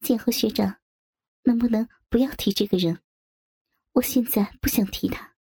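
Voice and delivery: mildly cold, restrained refusal; understated icy displeasure. A narrator's reading. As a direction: shut the topic down with a touch of chill. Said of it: "寒声说道".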